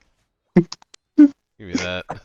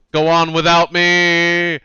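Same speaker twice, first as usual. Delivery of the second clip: overacted death scene; 5 semitones higher, much slower than usual. In-game character dying.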